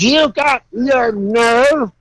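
slow, high, indignant "You got your nerve"; a small woman scolding the car owner, indignant; scolding old woman. Retold or imitated. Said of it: "She says".

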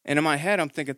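ordinary talk, nothing marked

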